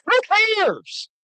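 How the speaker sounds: high scornful rant. Real, not acted. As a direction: sneer it, high and dismissive. Ranting at guest.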